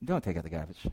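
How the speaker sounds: low, hushed inner tempter; soft, deep voice in the head; the tempting voice. Acted. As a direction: murmur it low, like a sly temptation. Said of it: "And the voice says".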